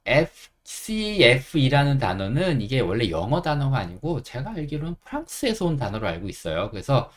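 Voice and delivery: usual speaking voice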